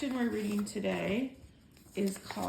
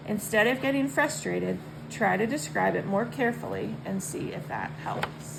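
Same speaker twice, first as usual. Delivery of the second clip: put-on louder read-aloud voice to kids; 6 dB louder than usual. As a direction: read it out clearly to the children. Reads the lesson text aloud.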